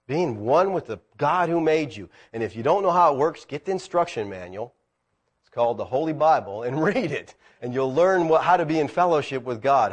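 ordinary talk

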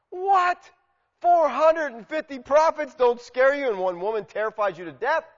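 loud, high-pitched disbelief; incredulous. Acted. Preacher challenging Elijah fleeing one woman, loudly incredulous. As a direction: blurt it out as an outraged question, easing at the end.

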